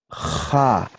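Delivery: "Ja" with a long rasped fricative J; scraped J. Shown as a demonstration. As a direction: scrape the throat on the J of "Ja". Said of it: "pero raspada".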